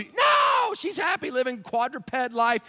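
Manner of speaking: high, loud exclaimed no; shouting the dog is content; emphatic shout. Real, not acted. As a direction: burst out in protest.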